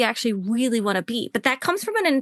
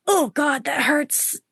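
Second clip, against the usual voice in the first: gasp, then a mild wounded exclamation; hurt reaction; 2 semitones higher, slower than usual. Acted. Coach voices her body's reaction to criticism, mildly hurt. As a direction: take in a sharp breath, lightly wounded. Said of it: "part of my body that goes".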